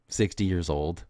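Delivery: usual speaking voice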